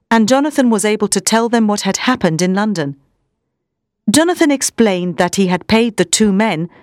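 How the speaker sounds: ordinary delivery, nothing marked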